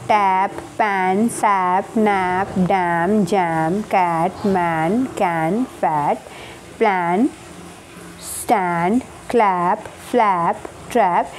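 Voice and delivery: टैप, पैन, each with an open ऐ, said slowly; model word list. Shown as a demonstration. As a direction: read the list for the class to repeat. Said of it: "Listen and repeat aloud". (धीमी आवाज़ में शब्द बोलकर सिखाना)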